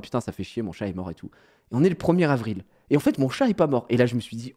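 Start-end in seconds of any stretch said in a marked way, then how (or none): 0.0-1.3 much lower, much softer mournful read; a low, hushed read of his own sad tweet; glum tweet voice. Retold or imitated.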